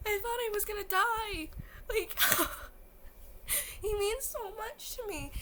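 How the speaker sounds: high, tearful voice, overcome; real crying. Real, not acted. Fan telling viewers what he means to her, crying. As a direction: choke it out through real tears. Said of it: "I'm crying now".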